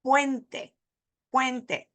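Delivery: puente in two syllables, "PWEN-te"; correct diphthong. Shown as a demonstration. Teacher repeating the bridge word, joined diphthong. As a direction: glide from u into e in puente. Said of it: "not puente".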